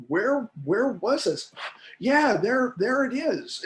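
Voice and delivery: rising, eager searching voice; excited discovery. Retold or imitated. Professor voicing her own brain finding an old memory, rising with eager discovery.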